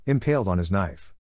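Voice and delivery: usual speaking voice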